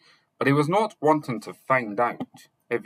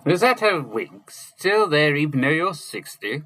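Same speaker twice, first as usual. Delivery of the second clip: put-on high, louder teasing old wizard; teasing exclamation; 7 semitones higher, 5 dB louder than usual. A narrator's reading. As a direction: tease him in a raised, odd voice. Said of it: "Merlin exclaimed".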